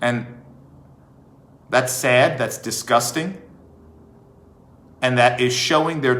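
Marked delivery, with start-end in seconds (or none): none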